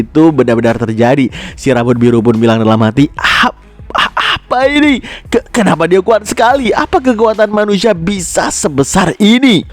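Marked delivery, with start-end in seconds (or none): 3.1-9.7 stammering, high-pitched disbelief; sangat kaget. A narrator's reading. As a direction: gasp out high, halting questions in shock. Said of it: "dengan wajah yang sangat kaget". (kaget tak percaya, nada tinggi terbata)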